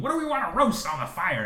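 upbeat camp leader question; cheerful scoutmaster asking the group; hearty outdoorsy cheer. Acted. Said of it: "scout leader asking campers".

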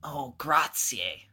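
drawn-out Italian grazie; Italian accent. Pretend. Pope thanks Cosimo for wine, slow mock Italian. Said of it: "this type of accent".

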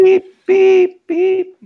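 high, loud reversing-truck beeps; truck backing up beeps. Retold or imitated. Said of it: "That's the Brinks truck backing up".

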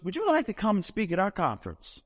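a hint of the chirpy caller; Southern lady voice. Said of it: "She goes".

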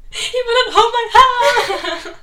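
slow, high, loud sob through laughter; laughing mock wail. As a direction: wail it while cracking up. Retelling to Jess, laughing.

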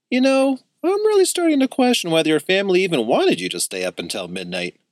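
shocked. A narrator's reading. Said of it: "Axel practically spit his drink out".

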